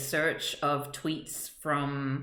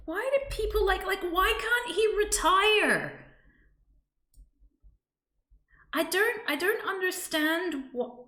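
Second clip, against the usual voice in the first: exasperated. Real, not acted. Exasperated rant about him retiring.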